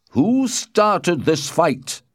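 high, loud, very slow question; stern demand. A narrator's reading. The Prince questions Benvolio, loud and stern. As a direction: demand it loudly, word by word.

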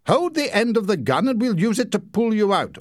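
high, firm command; ordering. A narrator's reading. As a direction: bark the rescue plan. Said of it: "ordered Leslie".

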